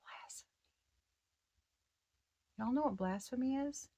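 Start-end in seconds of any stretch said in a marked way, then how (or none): 0.0-0.5 whispered. Retold or imitated. Repeating a word she hears.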